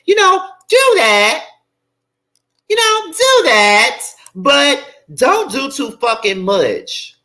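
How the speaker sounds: high, drawn-out mocking falsetto; sing-song it high and slow to mock him. Mocking [name] in a high falsetto.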